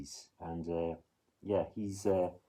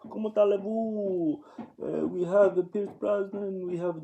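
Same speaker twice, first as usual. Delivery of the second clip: hammed-up French accent, much higher; mock French accent; 12 semitones higher, 5 dB louder, slower than usual. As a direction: ham up a cheesy French accent, pitched high. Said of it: "Pierce Brosnan does a better French accent than me".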